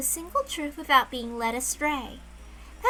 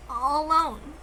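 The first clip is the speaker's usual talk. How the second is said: ordinary delivery, nothing marked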